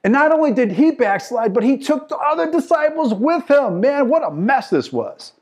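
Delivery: loud, high pulpit cry; fervent preacher in full flight; full-force preaching. Real, not acted. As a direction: drive the point home to the congregation.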